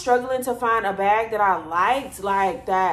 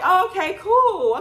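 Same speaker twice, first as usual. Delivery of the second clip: high, a bit louder, playing it cool; high mock casual; 10 semitones higher, 5 dB louder than usual. Reacting to her friend knowing him, high mock casual voice. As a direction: pitch it up like it's no big deal. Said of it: "And I'm like".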